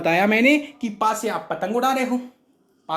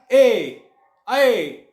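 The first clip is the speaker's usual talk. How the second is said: calling out; 4 dB louder than usual. Shown as a demonstration. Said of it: "जब आप बुलाएंगे ना".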